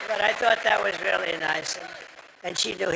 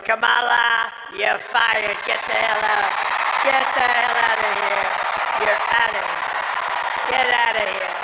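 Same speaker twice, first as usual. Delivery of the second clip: loud, raised shout of an imagined dismissal; mock firing shout; 2 semitones higher, 5 dB louder than usual. The crowd telling Kamala to get out, shouting it loud. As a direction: yell it like firing her on the spot. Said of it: "You're gonna say".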